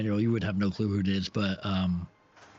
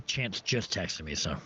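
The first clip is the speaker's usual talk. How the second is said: ordinary delivery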